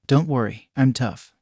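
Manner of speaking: usual speaking voice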